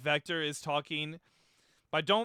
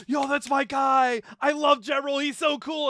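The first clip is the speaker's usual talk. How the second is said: excited shout; 8 semitones higher, 7 dB louder than usual. Retold or imitated. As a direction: yell it like a proud fan. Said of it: "I'm like".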